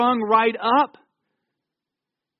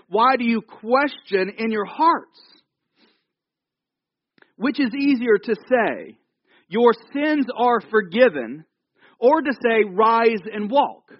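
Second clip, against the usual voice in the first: slow weighty challenge; 4 semitones higher, 3 dB louder, slower than usual. Acted. Jesus answering the scribes.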